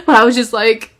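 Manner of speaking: ordinary delivery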